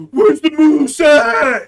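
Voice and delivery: slow, loud, drawn-out goofy voice; loud slow caricature voice; mock tourist. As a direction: ham up a clueless tourist. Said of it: "you don't think I'm gonna fucking be like".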